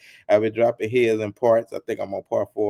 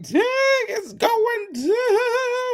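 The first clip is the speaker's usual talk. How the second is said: stretched, very high and slow; mock high squeal; much slower than usual. Friend retelling Chopper's reaction, in a stretched high squeal. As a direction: squeal it out, drawn long and high. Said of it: "He was like".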